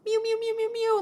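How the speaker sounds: very high, thin little mews; cat meowing. Retold or imitated. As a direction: mew like a cat outside the door. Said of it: "meows right outside. ... like this".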